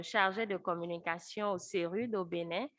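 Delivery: ordinary delivery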